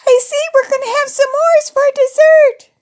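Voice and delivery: high, loud, delighted squeal of a line; excited child. Acted. Crystal spotting the dessert supplies, high and excited. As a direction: exclaim it high and loud with glee. Crystal spots the s'mores ingredients.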